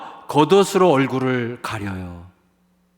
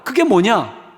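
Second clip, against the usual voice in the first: ordinary talk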